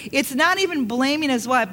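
normal talking voice

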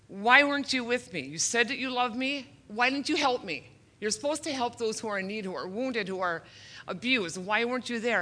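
put-on a wounded child asking where he was; anguished plea. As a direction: call out to God, hurt and demanding. Retells crying out to God.

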